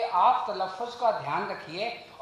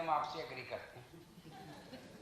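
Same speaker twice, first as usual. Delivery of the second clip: hushed, slow, much softer private admission; quiet confiding; 5 semitones lower, 14 dB quieter, slower than usual. Retold or imitated. Interviewer privately agreeing after the show, hushed and slow. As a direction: drop the voice as if telling a secret off-air. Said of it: "जब interview ख़त्म हुआ तो कहते हैं".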